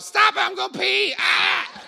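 mock lady shouting. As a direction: squeal it like a woman laughing too hard to hold it in. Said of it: "some lady who will shout".

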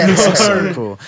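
high, loud thrilled gush; bubbling over with excitement; excited. Retold or imitated. Thrilled at meeting the band.

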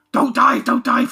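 high, loud mock pleading; mock panic. Islanders to the giant monster, mock panic. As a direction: beg in comic alarm. Acting people riding a diving monster.

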